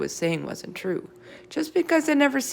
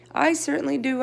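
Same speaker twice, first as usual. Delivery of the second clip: shouted; 10 semitones higher than usual. A narrator's reading. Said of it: "I shouted".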